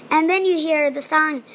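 normal talking voice

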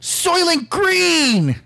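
high, drawn-out melodramatic cry; mock horrified cry. Reacting to a friend's cannibal crab theory, mock horrified cry. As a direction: wail it like a film's final revelation.

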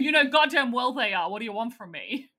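loud exasperated outburst; mock angry Mike. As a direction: snap back in mock fury. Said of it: "And Mike says".

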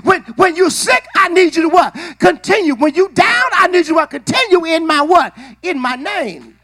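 shouted rhythmic preaching to the church; preaching at full force. Acted. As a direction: drive it home to the congregation.

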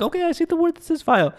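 very high, quick put-on grandma voice; squeaky, hurried imitation of grandma; voicing grandma. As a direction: rush it in a high mock-grandma pitch. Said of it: "She's like".

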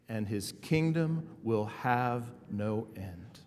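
slow, measured creed recitation; reciting slowly. Shown as a demonstration. Pastor leading the church in the Apostles' Creed, slow and measured. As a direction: lead the room through it phrase by phrase. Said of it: "We're gonna say it slowly".

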